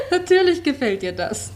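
light laugh sliding into the words; teasing laugh. Acted. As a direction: laugh knowingly, then tease. Actress teases about Luba's wish. (neckisch lachend)

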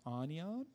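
"Onion" as a questioning AH-nee-ahn; unsure pupil misreading "Onion" as ah-nee-ahn?; misread word, unsure. Retold or imitated. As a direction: hesitantly mispronounce "Onion" as ah-nee-ahn, rising at the end. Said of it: "not being able to sound out anion".

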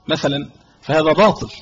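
ordinary delivery

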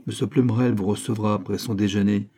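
plain reading voice

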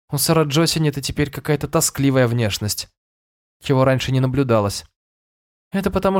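put-on high, girlish princess voice; a bright young girl explaining. A narrator's reading. (голос юной принцессы)

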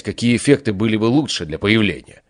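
plain reading voice

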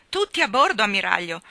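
spirited, emphatic echo of a command; forceful reply. A narrator's reading. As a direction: call the order straight back with force. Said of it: "ella rispose con forza".

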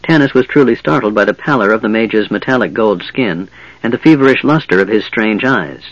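plain reading voice

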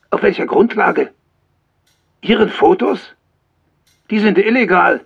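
angry scolding. Acted. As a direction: tear into him for the illegal photos. Sergeant scolds reckless writer.

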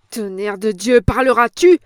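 an impatient, furious yell; furious shout. A narrator's reading. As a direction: bark the question at her. Boss roars at old woman.

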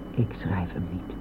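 zacht en laag gefluisterd; fluisterend. Acted. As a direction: fluister het, vastberaden en stil. Oude vrouw weigert te schrijven. (vastbesloten fluisteren tegen de buschauffeur)

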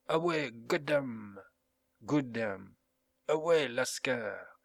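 slow, drawn-out battle cry in an English voice; English captain's cry. A narrator's reading. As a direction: call the men on, stretching each word. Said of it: "cria le capitaine anglais". (cri traînant de capitaine anglais)

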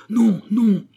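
very high, loud refusal; emphatic double cry; crowd's shouted reply. A narrator's reading. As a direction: shout it back as a whole crowd would. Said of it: "répondirent toutes les voix".

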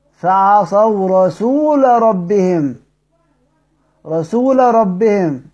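slow recitation of رسول ربهم with a clear lam. Shown as a demonstration. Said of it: "هي دي مظهرة".

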